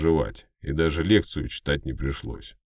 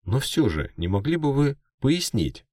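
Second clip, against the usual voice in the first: much higher, trembling question; nervous reporter; 11 semitones higher, 3 dB louder than usual. Acted. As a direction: ask it with a shaking voice. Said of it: "голос у него явно дрожал".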